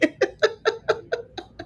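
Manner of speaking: high, trailing giggle; real amused laugh. Real, not acted. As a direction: let a high laugh run on. Amused by a viewer's story.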